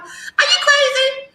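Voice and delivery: stretched high disbelieving cry; incredulous. Real, not acted. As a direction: pitch it way up in shock. Outraged rhetorical question.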